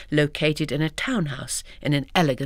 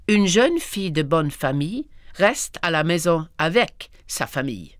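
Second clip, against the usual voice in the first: French uncle. Acted. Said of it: "This time he added".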